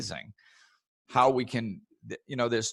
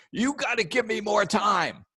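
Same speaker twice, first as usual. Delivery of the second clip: pleading; 12 semitones higher, 3 dB louder than usual. Retold or imitated. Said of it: "if you're on the phone going".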